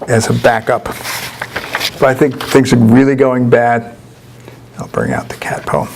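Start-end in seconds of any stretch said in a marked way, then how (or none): 4.8-5.9 low joking whisper to the audience; much lower, voiceless throwaway whisper; whispered aside. Real, not acted. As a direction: breathe it out like a secret punchline. Joking aside about backup poem.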